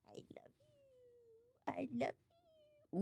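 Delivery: high breathy i love you; whispered pet talk; soft cooing. Real, not acted. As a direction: coo it to the cat.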